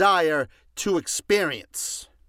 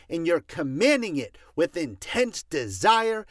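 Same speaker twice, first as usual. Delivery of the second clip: forceful preaching; 3 semitones higher than usual. Acted. Preacher hammering home a point.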